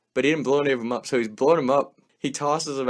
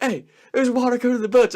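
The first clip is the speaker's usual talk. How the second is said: high, loud alarmed call; nervous warning; 12 semitones higher, 5 dB louder, faster than usual. Retold or imitated. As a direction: warn everyone the boat is filling. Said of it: "They're like".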